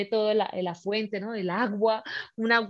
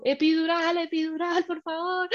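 mock screamed plea; 7 semitones higher than usual. Labouring woman begs for epidural, mock screamed plea. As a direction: act out begging at a scream. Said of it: "Y yo gritando".

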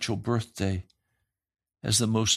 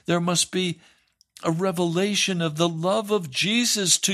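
higher-pitched impassioned sermon voice; fiery preaching; 7 semitones higher than usual. Acted. Preacher urging listeners toward Jesus, impassioned and raised. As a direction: preach it with rising fervour.

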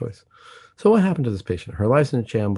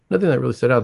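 ordinary talk

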